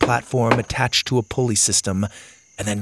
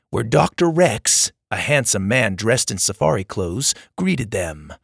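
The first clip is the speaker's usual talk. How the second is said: usual speaking voice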